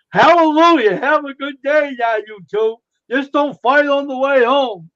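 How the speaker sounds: loud and high, a hammed-up hallelujah; mock cheery send-off. The speaker waving off the two quarreling Mormons, loud, hammed-up cheer. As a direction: cheer them off the lawn with mock joy.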